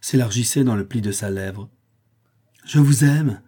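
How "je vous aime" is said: raised, exasperated outburst; desperate exclamation. A narrator's reading. Young woman throwing her suitor's declaration back, crying out desperately. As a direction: cry it out, higher and louder. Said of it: "s'écria-t-elle, en levant un regard désespéré".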